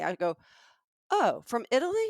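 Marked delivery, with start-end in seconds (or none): 1.1-2.1 skeptical pushback. Retold or imitated. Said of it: "So I kinda pushed back".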